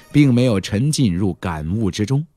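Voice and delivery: plain reading voice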